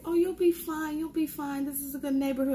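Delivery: put-on high, dismissive girlfriend voice; breezy friend. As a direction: brush off the worry like the carefree friend. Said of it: "And she's like".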